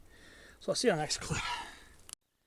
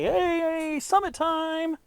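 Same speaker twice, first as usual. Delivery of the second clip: much higher, louder, celebrating; cheering; 11 semitones higher, 6 dB louder, much slower than usual. Pretend. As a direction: cheer like he has made the summit. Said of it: "to where I wanna say".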